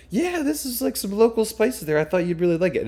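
high, sweet imitation of girlfriend; imitating girlfriend. Imitating Reagan handing over spices in a high sweet voice. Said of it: "she was like".

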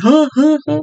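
acting half deaf. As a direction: play someone who can't hear and keeps asking. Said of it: "I'll be like".

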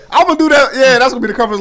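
thrilled by the comic cover idea; excited. Real, not acted. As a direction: hype yourself up. Excited about drawing comic cover.

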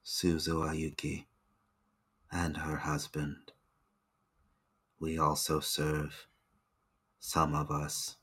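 low, hushed loyal retainer; hushed servant voice. Acted. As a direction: speak softly like an entranced servant. NPC answers the questions.

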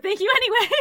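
breaks into a high laugh. Real, not acted. Laughing shout-out.

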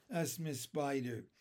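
ordinary delivery

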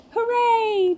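high, loud, sustained cheer; cheering yell. A narrator's reading. Tinkerbell celebrates the party starting, yelling. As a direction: whoop it out in celebration. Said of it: "Tinkerbell yelled".

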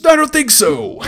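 Rex voice. Retold or imitated. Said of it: "And he's like".

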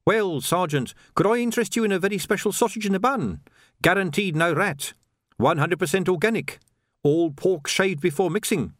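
high, eager street trader; lively high-pitched salesman patter; eager pie seller. A narrator's reading. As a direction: pitch it up like a chatty young hawker.

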